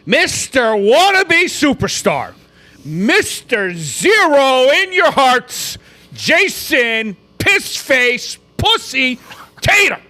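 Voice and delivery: slow, drawn-out sneering introduction; mocking rant. Real, not acted. Fan opens a rant at a failed star, slow and mocking. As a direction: stretch every insult out like a ring announcement. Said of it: "let me go on my little fucking rant".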